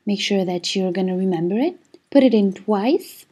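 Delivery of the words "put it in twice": usual speaking voice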